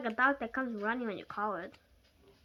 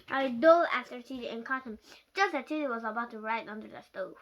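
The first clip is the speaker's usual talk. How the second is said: usual speaking voice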